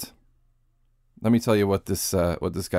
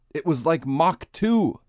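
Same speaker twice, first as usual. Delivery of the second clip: slow, higher, lightly acted pilot quote; quoted pilot; 6 semitones higher, slower than usual. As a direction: read the pilot's words slowly and higher.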